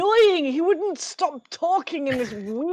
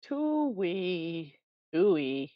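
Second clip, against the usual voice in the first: Scottish crone; 2 semitones lower, 5 dB quieter than usual. Acted. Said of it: "My accent's changed now as well".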